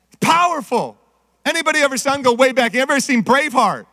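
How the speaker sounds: ordinary talk, nothing marked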